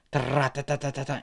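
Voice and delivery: rattling mouth machine gun; the narrator voicing the gunman's burst of shots; gunfire noise. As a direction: spit out a rapid-fire tatata. Imitating machine-gun fire.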